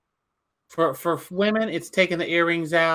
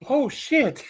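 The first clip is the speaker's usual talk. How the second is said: shocked oh shit; 8 semitones higher than usual. Retold or imitated. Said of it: "Shivani was like".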